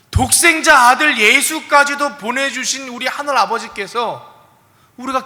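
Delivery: preaching. Acted. Preaching at full force.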